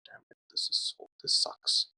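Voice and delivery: ordinary talk, nothing marked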